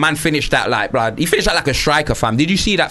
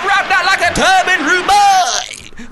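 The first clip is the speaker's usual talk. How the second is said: goal celebration shout; slower than usual. Real, not acted. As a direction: hype the goal up.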